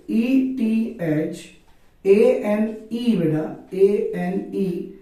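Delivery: slow, deliberate letter by letter; slow spelling. Shown as a demonstration. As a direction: spell each letter out slowly.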